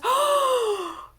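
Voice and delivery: high, loud acted gasp of wonder; amazed gasp. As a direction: gasp at a lovely sudden sight. Goddess sees her beautiful reflection.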